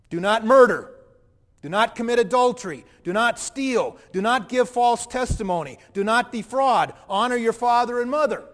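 loud, measured list, each do not stressed; firm recitation. Retold or imitated. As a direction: recite the commandments like decrees. Jesus reciting the commandments.

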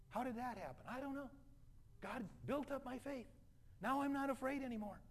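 wondering believer. Acted. Believer finding the fear is gone, in wonder. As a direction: voice a believer marvelling, high and light.

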